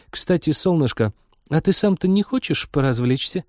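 high, coquettish invitation; flirty virtual cupid. Acted. Virtual cupid teases the captain in bed, flirty.

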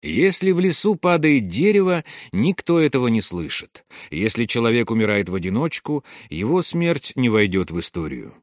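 usual speaking voice

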